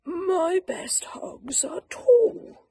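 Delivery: high slow airy musing; hopeful thought. Acted. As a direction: stretch upward on tall, slow and airy. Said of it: "thought Douglas".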